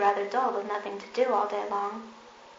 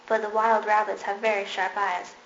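usual speaking voice

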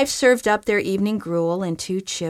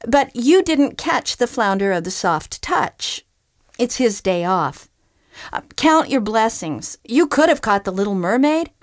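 higher and louder, sardonic; wry groundhog; 4 semitones higher, 4 dB louder than usual. Acted. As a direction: lecture him on his luck. Groundhog retorts to fisherman.

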